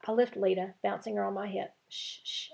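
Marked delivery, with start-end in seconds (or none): 1.9-2.5 unvoiced, hushed shh; soft shushing. A narrator's reading. Girl shushes her baby sister in a hushed whisper. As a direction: hush the toddler gently like Dora. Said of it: "I say like Dora".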